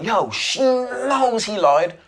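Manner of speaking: high, slow, drawn-out excitement; thrilled slow-motion realisation; excited realisation. Retold or imitated. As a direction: say it like you just caught on. Excited realisation watching the scene.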